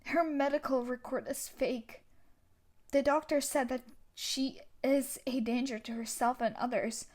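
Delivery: usual speaking voice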